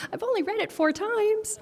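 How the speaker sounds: laughing through tears, high; teary laugh. Real, not acted. Reader laughs through tears after the moving story. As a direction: laugh off real emotion, choked up. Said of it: "without crying".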